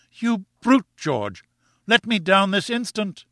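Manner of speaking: high-pitched furious command; indignant demand. A narrator's reading. Wife on pedestal ordering husband, indignant demand. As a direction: order him in a high, outraged voice.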